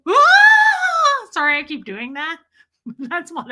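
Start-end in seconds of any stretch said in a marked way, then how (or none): 0.1-1.3 very high, loud, drawn-out wail; imitated scream. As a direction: shriek like a woman flying off. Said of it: "she went".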